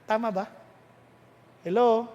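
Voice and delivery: ordinary talk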